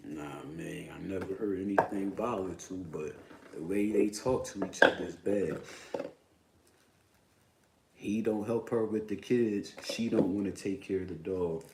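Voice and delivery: normal talking voice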